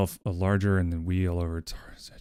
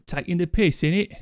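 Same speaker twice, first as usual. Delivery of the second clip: mock London accent; 8 semitones higher than usual. Mock British slang.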